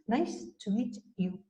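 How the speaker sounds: "nice to meet you" slowly, endings finished; slow, disciplined. Shown as a demonstration. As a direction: take your time over each word. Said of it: "tenemos que tener esa disciplina". ("nice to meet you" lento y disciplinado)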